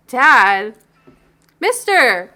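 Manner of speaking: barista in a new put-on voice; changed voice. Barista answers the dad, in yet another put-on voice. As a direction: switch to a different odd voice. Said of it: "His voice keeps changing".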